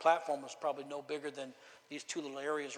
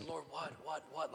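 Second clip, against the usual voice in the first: retold prayer; 9 dB quieter than usual. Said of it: "like that".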